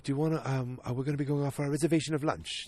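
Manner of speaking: lightly British, quick and prim; a hint of a British office manager, brisk; mild mock-British Lane Price offering lunch; Lane Price impression. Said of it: "This is my Lane Price".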